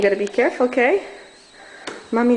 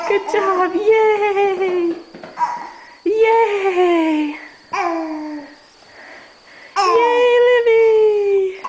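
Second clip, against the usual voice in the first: excited cheering; 8 semitones higher, 3 dB louder, much slower than usual. Real, not acted. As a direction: cheer her on, bright and high. Cheering toddler climbing stairs.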